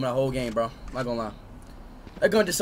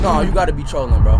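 ordinary delivery

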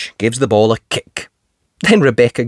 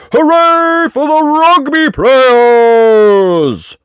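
high, slow, louder cheer; loud hooray; 12 semitones higher, 4 dB louder, much slower than usual. Acted. Daddy Pig cheering the rugby players, loud.

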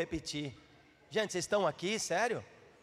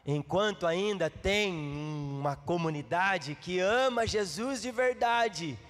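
drawn-out words climbing in pitch; fervent preaching; 6 semitones higher, 4 dB louder than usual. Real, not acted. Preacher challenging the church on loving Jesus, fervent and rising. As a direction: build to a high, urgent preacher's cry.

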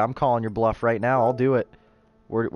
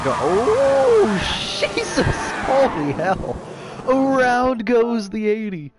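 excited shouting; 13 semitones higher, 5 dB louder, much slower than usual. Real, not acted. Crash during race.

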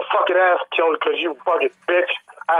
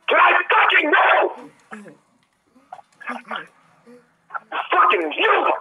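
angry yell; 8 semitones higher, slower than usual. Real, not acted. Gaming rage outburst.

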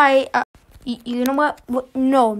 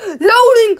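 louder and higher, exasperated; impatient shout; 5 semitones higher, 6 dB louder than usual. Real, not acted. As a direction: yell it at the slow screen.

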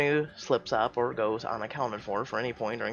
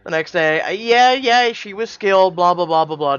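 mocking dismissive; 5 semitones higher, 12 dB louder than usual. Real, not acted. Mocking the narration.

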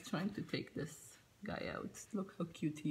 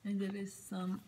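ordinary delivery

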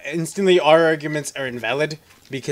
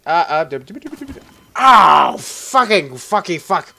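panicking gamer; 7 dB louder, slower than usual. Real, not acted. Stammering in panic.

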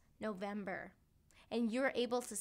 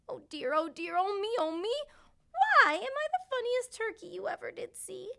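sad turkey lament; 8 semitones higher, 7 dB louder than usual. A narrator's reading. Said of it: "Timmy answered".

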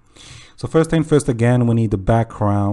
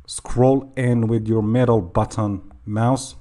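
slower, more careful repeat; slowed down; slower than usual. Real, not acted. Tutor repeating the mouse instruction for learners, slowed down. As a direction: take each word slowly and separately. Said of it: "sorry I said that too fast".

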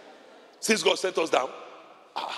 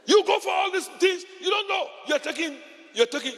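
scolding shout; 14 semitones higher than usual. Real, not acted. Preacher scolding careless tourists.

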